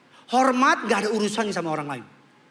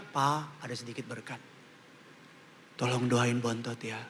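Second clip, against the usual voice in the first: respectful son; 10 semitones lower, 7 dB quieter than usual. Retold or imitated. Son respectfully handing father money.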